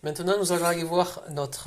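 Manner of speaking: ordinary talk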